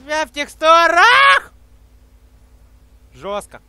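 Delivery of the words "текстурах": slow yelled disbelief; excited shout. Real, not acted. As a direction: yell it out, amazed. Stuck in textures, yelling.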